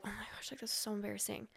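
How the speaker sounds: mortified whisper to a friend; hushed embarrassed whisper. Retold or imitated. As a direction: hiss it so nobody nearby hears. Retelling her mortified aside.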